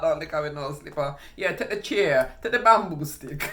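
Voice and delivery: imitated mother. Mother telling teachers what to beat her child with, emphatic. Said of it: "She talking about".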